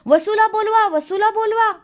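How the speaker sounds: high-pitched, excited calling out; loud clamouring shout. A narrator's reading. All the children clamouring for Vasu to be fetched, loud shouting. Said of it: "सर्व मुलांनी एकदम मोठ्याने गलका केला".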